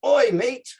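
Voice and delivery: playful Australian shout; Australian "oi, mate". Pretend. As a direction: hail them like an Australian footy mate. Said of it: "any Australian soccer players here?".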